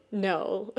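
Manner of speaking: high burst of laughter; amused chuckle around a quick no; laughing. A narrator's reading. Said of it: "And Shiro does laugh".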